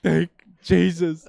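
loud, high, drawn-out thanks; hammed-up cry of deliverance, stretched slowly; exaggerated relief. Driver spared a ticket, hammed-up loud relief.

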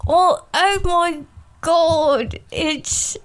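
slow, hammed-up British actor; Michael Caine. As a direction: drag it out in a broad put-on English accent. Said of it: "Say one other thing as Michael Caine".

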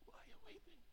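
"Why are you weeping?" breathed in a whisper. Acted. Same question whispered.

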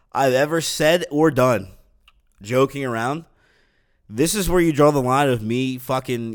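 normal talking voice

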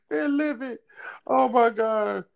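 high mock squeal. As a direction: shriek it like a scandalized fan. Mocking angry Xbox fans.

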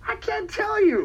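coy tease; high mock-coy refusal; teasing. Teasing refusal to reveal surprise.

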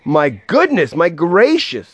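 high, astonished exclamation; amazed crowd voice; shouted. A narrator's reading. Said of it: "they shouted".

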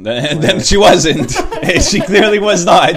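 laughing. Real, not acted. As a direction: chuckle it out. Laughing at a failed match.

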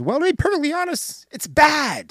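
fast, high-pitched mock banter; quick mock exchange. Fast back-and-forth of retold figures.

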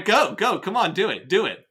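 plain reading voice

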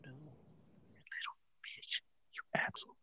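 very faint, secretive name-calling; barely audible mock abuse; whispered insult. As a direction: curse under your breath in a whisper.

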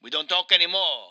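put-on Italian accent, aggrieved game character; Mario with Italian accent. Mario complains to his interviewer, in a thick Italian accent. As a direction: play an indignant Italian Mario.

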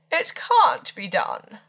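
loud, high, slow refusal; emphatic porter's no, drawn out; officious refusal. A narrator's reading.

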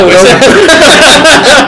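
laughing, pitch jumping up; laughing together at the joke. Real, not acted. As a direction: break into laughter mid-word. Group laughing at dark joke.

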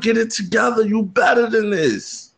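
high, urgent pep-talk shout; yelling at himself. Retold or imitated. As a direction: scold yourself in the mirror, pitch jumping up. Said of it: "I was yelling at myself".

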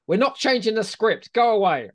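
high, loud mock-furious refusal; angry retort. Retold writer shouting down the phone at the editor, high and loud. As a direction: slam the door on him with your voice. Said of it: "grabs the phone off him and goes".

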